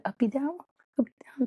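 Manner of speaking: put-on "Uppy down, uppy down" in a high little-kid voice; "Uppy down" sung out high and childlike; imitating her toddler. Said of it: "So she said".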